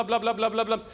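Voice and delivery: usual speaking voice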